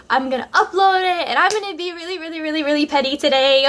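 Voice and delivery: high, put-on voice, gleeful and petty; petty scheming. Retelling her plan to make him jealous: high, put-on petty voice. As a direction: sell the revenge plan with glee. Plotting revenge post.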